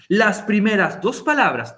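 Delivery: ordinary talk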